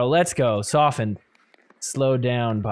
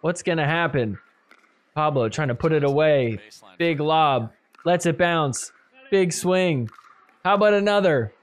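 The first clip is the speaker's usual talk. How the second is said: ordinary talk, nothing marked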